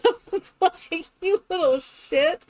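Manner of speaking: high, laughing mock scolding; words breaking up with laughter. Real, not acted. To the teenager caught teasing, laughing.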